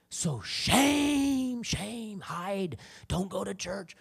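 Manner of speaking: the tempter urging them to hide; shaming enemy. Acted. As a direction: condemn them in a sinking, hushed voice. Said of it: "how the enemy plays it".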